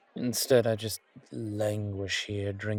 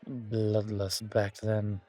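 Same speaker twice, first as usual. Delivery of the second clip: usual speaking voice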